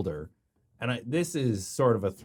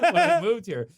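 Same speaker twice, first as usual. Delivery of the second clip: louder, higher laughing words; 8 semitones higher, 7 dB louder than usual. Real, not acted. Comic recalling moving from the Yukon, laughing. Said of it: "laughs while speaking".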